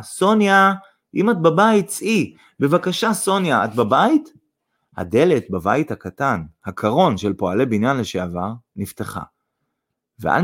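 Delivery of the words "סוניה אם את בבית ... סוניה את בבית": high, raised calling voice; calling out loudly. A narrator's reading. Son at the gate calls Sonya, calling out loudly. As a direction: call out to someone inside the house. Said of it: "בקול רם קרא".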